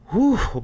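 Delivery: high mock sigh of relief; relieved whew. Fans exhaling in relief at the title change, mock whew. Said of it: "everybody's like".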